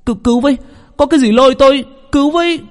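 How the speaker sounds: high-pitched, desperate drowning cry; panicked cry for help. Acted. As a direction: scream for rescue as something drags you under. Drowning man cries for help. (tiếng kêu cứu hoảng loạn, cao vút)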